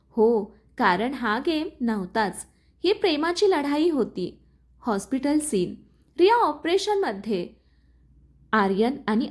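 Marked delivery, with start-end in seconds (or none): none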